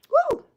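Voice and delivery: very high cheering whoop. Real, not acted. Cheering Joyce on after her words, high whoop. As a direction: let out a joyful woo.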